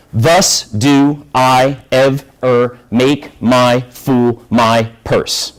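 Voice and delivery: very slow, one syllable at a time; evenly spaced beats, a gap after each syllable; counting out syllables. Shown as a demonstration. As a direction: measure out the verse syllable by syllable. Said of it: "The number of syllables in a line".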